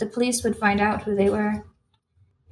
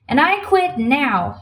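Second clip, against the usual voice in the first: slow, emphatic, higher-pitched outburst; furious; 4 semitones higher, 3 dB louder, slower than usual. A narrator's reading. Waitress finishing her resignation, furious. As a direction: drive each word home angrily. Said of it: "waving her arms furiously".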